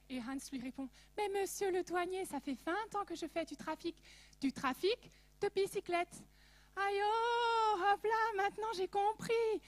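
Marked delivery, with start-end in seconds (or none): 1.2-6.2 put-on punchline in a thick Alsatian accent, pitched higher; sly Alsatian smuggler's answer in a strong Alsatian accent. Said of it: "mon plus bel accent alsacien". 6.8-9.6 put-on high, loud Alsatian-accented yelp of realization; much higher, louder Alsatian "Aïe, hop là"; Alsatian exclamation. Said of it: "mon plus bel accent alsacien".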